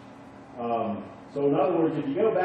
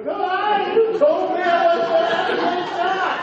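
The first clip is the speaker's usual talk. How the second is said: put-on high, loud, betrayed little-boy shout; child's outraged cry; 7 dB louder than usual. Son cries out at dad in the ER, high loud protest. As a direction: yell it like a five-year-old who was lied to. Son's betrayed outburst at doctor.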